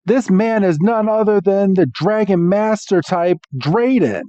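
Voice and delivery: mock-dramatic reveal; grand introduction. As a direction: present a legend, lightly hammed up. Student reveals who the man is.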